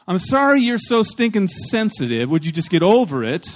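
exasperated mock apology, starting high; sorry with attitude. Pastor mimics an offender annoyed at the hurt one, mock exasperated. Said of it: "You can say sorry with an attitude".

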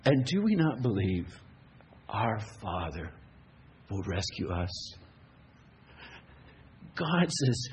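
ordinary talk, nothing marked

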